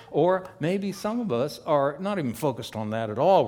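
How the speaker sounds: ordinary delivery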